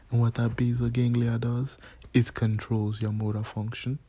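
mock Jamaican oncologist; Jamaican accent. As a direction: explain like a doctor in a put-on Jamaican accent. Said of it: "a Jamaican oncologist".

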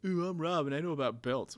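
a put-on mocking impression of Rob. Mocking his co-host Rob in a put-on voice. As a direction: do a teasing take on your friend.

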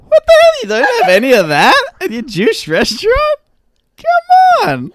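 high, loud comic tirade; shrieked, hammed-up protest; mock outrage. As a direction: explode at the waiter in pretend fury. Playing outraged restaurant customer.

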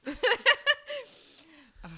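high laughing ugh; laughing groan. Real, not acted.

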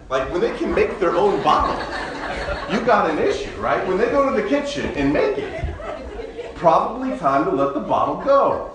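laughing. Real, not acted. Pastor joking to the congregation about kids with bottles, laughing. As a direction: laugh through the joke.

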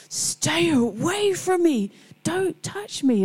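high, drawn-out mock shriek; imitated hostile cry, stretched out and high-pitched; hostile outburst. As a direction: act out a hostile patient warding people off. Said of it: "she would get very hostile".

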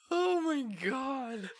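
slow, high, wheezing through laughter; laughing. Real, not acted. Host still cracking up after the cum box joke. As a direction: gasp it out mid-laugh.

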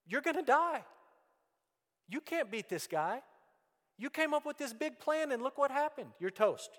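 preacher voicing a wife who says you're finished; nagging wife. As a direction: play the scolding wife telling him he's done for. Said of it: "That's what his wife just told him".

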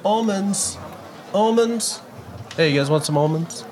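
calling out to passersby; street vendor calling. Acted. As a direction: hawk the almonds like a market seller.

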